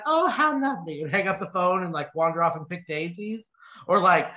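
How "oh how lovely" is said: put-on airy old nun. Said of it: "she'll be like".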